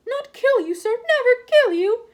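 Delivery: high, squeaky elf voice, slowing and pleading; pleading house elf. A narrator's reading. House elf denying he meant harm, squeaky and pleading. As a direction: protest earnestly, dragging it out.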